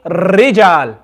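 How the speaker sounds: "rijal" with a long rolled r, said slowly; overdone trill. Shown as a demonstration. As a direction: roll the r of "rijal" too much. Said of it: "I've made the shiver too much".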